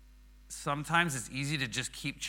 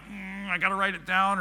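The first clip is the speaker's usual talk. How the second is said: higher-pitched, interrupting own thought; distracted urgency; 7 semitones higher, 4 dB louder than usual. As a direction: act out a sudden nagging reminder. Acting out restless list-making thoughts.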